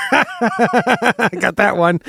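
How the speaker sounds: laughing through the words; giggling, slow, high. Real, not acted. Laughing while claiming the button.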